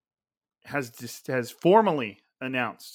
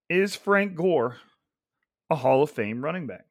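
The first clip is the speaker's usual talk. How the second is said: slow, emphatic question, pausing after the name; measured question, broken in two by a pause; deliberately slow; 2 semitones higher than usual. Real, not acted. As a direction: pose it slowly so they really think. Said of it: "saying this slowly right now".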